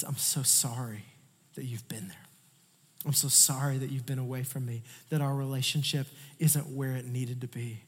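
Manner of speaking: tender father. Retold or imitated. Father's tender words to son.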